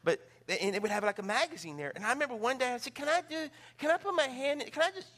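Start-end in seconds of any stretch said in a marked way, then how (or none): 3.0-5.1 put-on high pleading little kid voice; a child whining to put a hand in; begging child. Child begs grandma, high pleading little voice.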